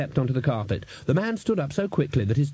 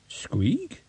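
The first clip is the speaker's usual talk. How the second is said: squeaky rat voice; 5 semitones higher than usual. Acted.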